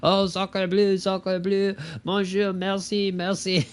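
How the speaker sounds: high, hammed-up French accent; squeaky French peasant accent; mock French accent. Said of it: "Terence was doing an impression of him".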